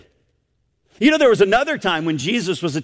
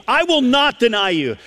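"not deny" pressed hard in raised pitch; raised, insistent "I will not"; emphatic vow; 6 semitones higher than usual. Retold or imitated. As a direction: insist on "not" as a firm promise. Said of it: "he says it in such an emphatic way".

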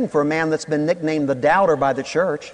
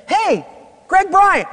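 mistaken greeting; 14 semitones higher, 4 dB louder, slower than usual. Retold or imitated. Greeting wrong man.

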